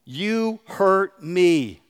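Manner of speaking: very slow, each word weighed out; drawn-out heartfelt admission; slow and deliberate. Shown as a demonstration. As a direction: spell out the hard words slowly. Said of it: "say those three hard words".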